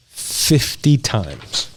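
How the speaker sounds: drawn out, very slow reveal; dramatic slow. Real, not acted. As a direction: let it land slowly. Dramatic reveal of pepperoni fact.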